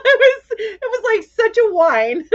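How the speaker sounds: high laughing words. Real, not acted. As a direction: laugh through the joke. Laughing at her own pun.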